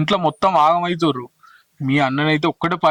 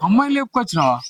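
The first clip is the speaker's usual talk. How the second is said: shocked; 6 semitones higher than usual. Acted. Shocked at eloped girl news.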